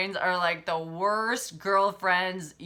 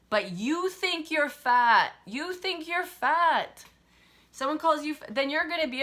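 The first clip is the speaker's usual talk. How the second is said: emphatic insistence; 8 semitones higher, 6 dB louder than usual. Real, not acted. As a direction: hammer home that they think they're fat. Hammering home the point.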